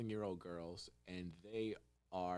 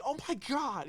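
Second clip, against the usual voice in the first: high, loud mock despair; a squeal of disbelief at the extra work; dismayed oh my god; 7 dB louder than usual. Podcaster acts the worker's horror at a redo. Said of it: "And you're like".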